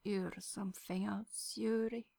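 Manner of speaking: soft, slow sleepy murmur. A narrator's reading. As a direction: murmur it half asleep. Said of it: "Victor murmurs again".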